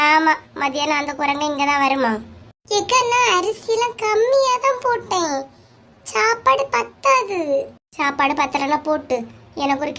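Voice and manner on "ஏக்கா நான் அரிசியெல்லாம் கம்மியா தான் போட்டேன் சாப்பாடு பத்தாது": high, whiny drawn-out plea; put-on high voice begging off; whiny younger sister. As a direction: stretch it out slowly like a fussy younger sister. Sister pleads there's not enough rice.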